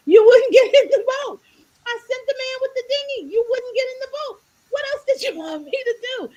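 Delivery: high, loud, laughing exasperation; exasperated Lord. Retold or imitated. The Lord scolding the man who drowned, exasperated and high. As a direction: throw up your hands, half laughing.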